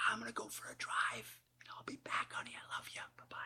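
acted whisper; barely audible, held-in farewell; half-voice anger. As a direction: whisper a restrained goodbye to your wife. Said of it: "lower your voice half".

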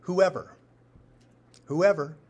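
loud, high repeated "Whoever", landing each one; emphatic build. Shown as a demonstration. Preacher driving home Jesus' promise to the congregation, loud and ringing. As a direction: hammer "Whoever" three times to seize attention. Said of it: "ready for this?".

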